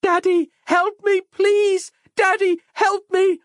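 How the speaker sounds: put-on high, loud panicked child's cry; child crying for help. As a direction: scream for daddy like a trapped little girl. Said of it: "I heard Gwendolen's cries".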